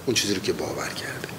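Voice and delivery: a whispered closing thought. Real, not acted. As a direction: drop to a breathy whisper. Teacher's hushed aside on belief.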